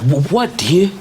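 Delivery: high, loud startled call; a man jolted by his beloved's voice; startled cry. Acted. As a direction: cry out as if suddenly called by name. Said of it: "And the man cried out".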